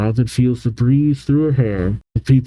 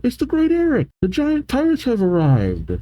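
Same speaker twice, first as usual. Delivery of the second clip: calling out; 11 semitones higher than usual. Acted. Little kid announcing the pirates' arrival, high mock call. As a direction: announce it high, like an excited child. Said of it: "calls out".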